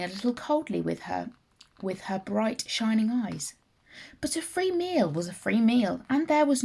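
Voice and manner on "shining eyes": usual speaking voice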